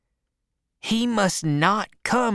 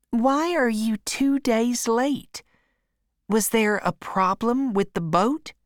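put-on higher, fond old aunt; Aunt Sally's voice; 6 semitones higher than usual. A narrator's reading. Aunt welcoming her nephew at the door, higher and fond. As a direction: gush over the boy like a doting aunt.

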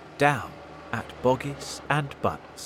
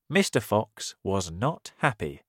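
plain reading voice

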